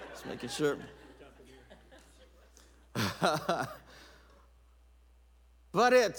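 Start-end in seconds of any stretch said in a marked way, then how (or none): 2.9-3.7 brief laugh at your own joke; short chuckle. Real, not acted. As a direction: chuckle to yourself. Laughs after joke about wife.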